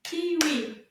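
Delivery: KI-WI, both syllables punched out loud; clapped syllables. Shown as a demonstration. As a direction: beat out Kiwi in two loud syllables. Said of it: "Can you clap the word kiwi".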